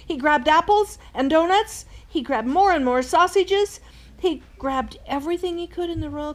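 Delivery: loud, high, building; frantic narration. A narrator's reading. Reader to children, loud frantic build. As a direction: pile on the urgency.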